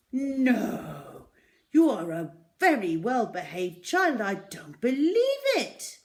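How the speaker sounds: loud, high protest; loud incredulous shopkeeper; disbelieving shopkeeper. A narrator's reading. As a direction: refuse to believe it of her. Raj voiced in story.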